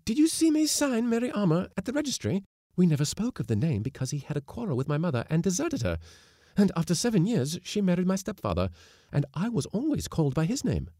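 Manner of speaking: high, eager rush of a voice; she's tumbling the story out; wife telling husband her father's story, high and eager; excited young wife. A narrator's reading. Wife explains her family, excited.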